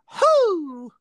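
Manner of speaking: shrill high "hoo" sound; high hoot buzzer. Shown as a demonstration. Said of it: "Mine's gonna be".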